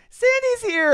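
high, loud squeal of delight; shrill mock squeal of delight; squealy impression. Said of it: "she goes".

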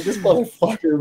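high-pitched exasperated grumble, acted out; retold irritation. Recalling the pushy teammate, retold exasperated irritation. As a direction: let retold irritation push the voice up. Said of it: "so irritated".

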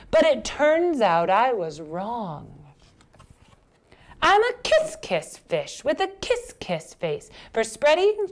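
high lilting fish voice, stretched endings; cheered-up fish. Acted. Fish declares himself a kiss-kiss fish, joyful. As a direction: announce the happy discovery in swinging rhythm. Fish's happy realisation.

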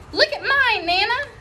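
put-on high, eager little girl; excited showing off. Grace jumping in to show Nana her pajamas, high and eager. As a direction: demand attention eagerly.

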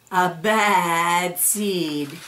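drawn-out, ominous refrain; slow ominous. A narrator's reading. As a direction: stretch each word out darkly. Refrain of the bad seed.

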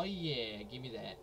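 ordinary talk, nothing marked

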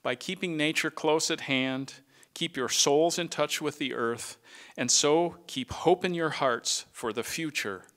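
benediction. Acted. Retiring director blesses his colleagues, solemn and firm. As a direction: send them off like a pastor's blessing.

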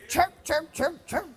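soft, weak hen chirps; a quiet, badly faked hen call; poor hen imitation. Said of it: "That was a poor imitation of a hen".

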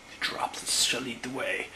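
hushed whisper, breathless detective. Acted. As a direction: whisper as if sneaking up. Officer searching for clues.

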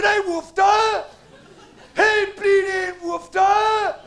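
slow, high, very loud bellow; hammed-up sergeant on parade; mock drill shout. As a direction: stretch it out like a parade command. Acting out parade-ground style exchange.

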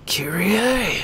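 thin, high mock cry; half-voiced hammed-up scream of a name; Nero yelling. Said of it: "inexplicably yelling her name".

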